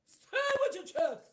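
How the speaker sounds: high and loud command; said with your chest. Shown as a demonstration. Urging the ladies, a loud commanding cry. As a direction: belt it out like an order.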